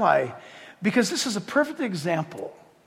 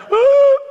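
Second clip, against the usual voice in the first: very high, loud caught-out squeal; startled ooh; 11 dB louder than usual. Retold or imitated. Hired mourners caught out, loud high startled squeal. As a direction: yelp like you've been caught. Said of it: "they're like".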